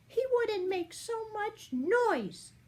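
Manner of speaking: high, light fairy voice; a sly little fairy thinking aloud; fairy's thought. A narrator's reading. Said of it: "she thought".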